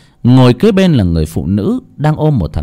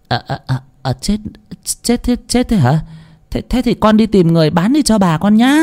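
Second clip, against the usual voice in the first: high, stammering cover-up; stall, then send her off in a flustered high voice; 10 semitones higher than usual. A narrator's reading. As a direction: caught out and fumbling for words. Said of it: "Bà Phú bối rối".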